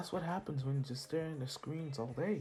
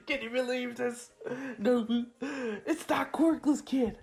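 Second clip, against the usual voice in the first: high, loud, gushing villain voice; unhinged villain Shigaraki gushing; manic villain fanboy; 9 semitones higher, 4 dB louder than usual. Acted. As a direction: gush over the kid like a crazed fan. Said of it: "and Shigar's like".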